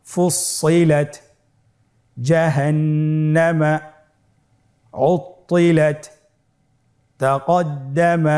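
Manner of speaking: each Arabic word read slowly with clear pauses; Quranic Arabic reading. Shown as a demonstration. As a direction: recite the Arabic words one by one, carefully. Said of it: "আমার সাথে পড়ুন". (আরবি শব্দগুলো ধীরে ধীরে তেলাওয়াতের ঢঙে)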